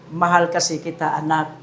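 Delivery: low, hushed, tender reassurance; loving parent. Acted. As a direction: drop low and soft, as to your own child. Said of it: "ang tono ng kanyang salita ay". (malambing at mahinang boses ng magulang)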